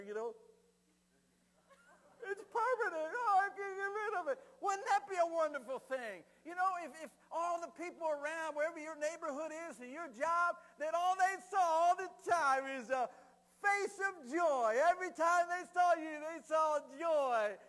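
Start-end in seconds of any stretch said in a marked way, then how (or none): 2.3-4.4 high, loud hammed-up wailing; mock panic. Preacher mocking fear of a permanent smile, wailing mock panic. Mocking worriers about wrinkles. 4.6-17.5 high, loud, full-voiced proclamation; exultant preaching. Real, not acted. Preacher picturing neighbours seeing only joy, exultant shouting.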